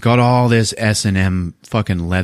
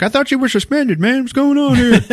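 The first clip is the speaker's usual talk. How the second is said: very high, squeaky phone voice; a hammed-up worried buddy; mock guy on phone. Guy on the phone asking the cop, very high and squeaky. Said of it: "The guy on the phone's like".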